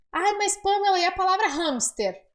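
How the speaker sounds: a lightly voiced curious student; mock student question. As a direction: play the puzzled learner objecting. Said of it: "imagined student question".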